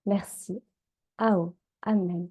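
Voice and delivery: ordinary talk, nothing marked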